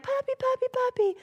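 high sing-song puppy calling. Retold or imitated. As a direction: coo the word up high at the dog.

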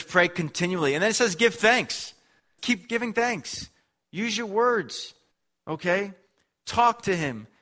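high-pitched, insistent sermon delivery; emphatic preaching. Real, not acted. Preacher urging the church to pray and give thanks, emphatic.

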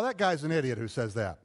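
ordinary talk, nothing marked

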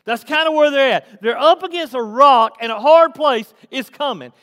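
forceful preaching. Acted. Preacher picturing millions trapped against the Red Sea, forceful preaching. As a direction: drive the scene home to the congregation.

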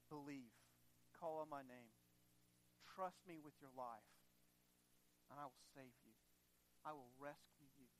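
low, soft, reassuring voice; gentle divine promise. Acted.

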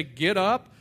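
loud imitated command; forceful, loud retold order. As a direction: give the order at full volume. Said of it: "and then say".

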